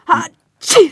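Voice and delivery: loud acted sneeze; big theatrical sneeze. Sick patient sneezes again.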